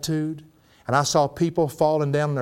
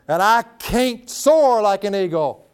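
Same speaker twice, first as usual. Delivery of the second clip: preaching climax; 6 semitones higher, 5 dB louder, slower than usual. Acted.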